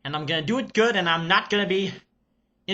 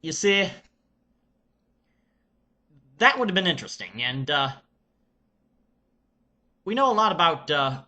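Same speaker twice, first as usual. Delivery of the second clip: put-on Serbian accent. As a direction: play an excited Serbian reviewer character. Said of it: "I shall get into character".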